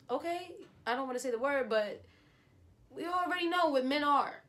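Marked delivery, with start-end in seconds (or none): 2.9-4.4 high, louder sassy jab at men; scorning men, pitched high and loud; sassy dig. Real, not acted. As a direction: throw shade at men in a high voice.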